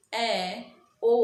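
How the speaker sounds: usual speaking voice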